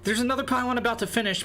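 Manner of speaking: high, fast excited call; excited shout. Real, not acted. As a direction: blurt it out in excitement. Caster reacts to a blunder.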